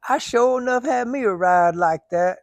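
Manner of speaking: slow, high put-on Cajun accent. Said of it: "He said".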